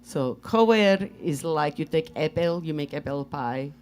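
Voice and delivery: put-on Russian accent. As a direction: explain it as Svetlana in Russian accent. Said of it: "Where did you learn the accent?".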